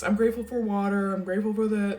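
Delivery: a touch of mock, careless gratitude; shallow gratitude. Shown as a demonstration. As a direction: toss off a hollow thank-you list. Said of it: "not talking about shallow things".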